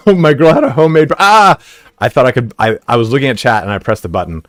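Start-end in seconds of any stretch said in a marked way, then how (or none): 0.1-1.6 sudden louder, higher cry mid-sentence; startled yelp. Real, not acted. Streamer startled by a game moment, yelping. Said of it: "in-game reaction, startled cry".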